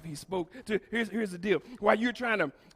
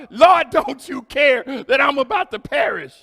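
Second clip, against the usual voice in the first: loud frightened plea to the Lord; panicked cry; 3 semitones higher, 7 dB louder than usual. Retold or imitated. Disciples in the storm crying out loudly to Jesus. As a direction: cry out as if the boat is sinking.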